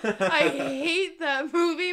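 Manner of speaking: loud, high, slow tearful wail with laughter in it; hammed-up despair, half crying, half laughing; mock anguish. Real, not acted. Telling friends about the heartbreaking movie, exaggerated tearful outburst. Overdramatic reaction to a sad movie.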